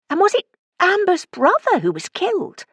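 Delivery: high-pitched, shocked asking; horrified question. A narrator's reading. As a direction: ask it aghast, voice leaping up. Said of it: "I asked, horrified".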